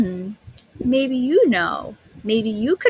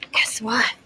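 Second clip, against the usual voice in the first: whispered tease. Real, not acted. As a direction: whisper it like a surprise. Teacher teases the class.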